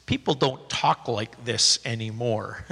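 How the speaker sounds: low, slow, deadpan movie quote; quoting a movie line. Retold or imitated. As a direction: lightly mimic the actor's dry delivery. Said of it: "he says".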